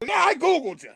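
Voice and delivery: high, loud mock neighbor; neighbor's smug reply. Neighbor Todd boasting he googled the teller, high smug voice. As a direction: play him proud of his snooping.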